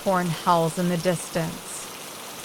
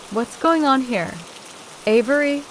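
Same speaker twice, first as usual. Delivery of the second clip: a hint of a questioning grandmother, pitched higher; higher voice, lightly playing a grandma who walks in; grandma's questioning voice, lightly done; lightly voiced grandma; 5 semitones higher than usual. A narrator's reading. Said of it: "Grandma's voice".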